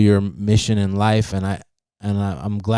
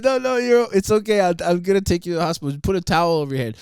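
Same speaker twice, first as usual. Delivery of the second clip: panicked Joe; 8 semitones higher, faster than usual. Retold or imitated. Retelling Joe insisting he drive, high and hurried.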